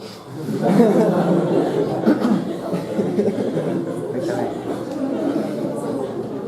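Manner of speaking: breathy, rising laugh at the story; long amused laughter; long real laugh. Real, not acted. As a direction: burst out laughing at the memory. Teacher laughing at own anecdote.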